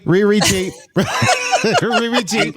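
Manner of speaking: laughing through a mocking nickname; giggling mock nickname. Real, not acted. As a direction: chuckle the nickname out.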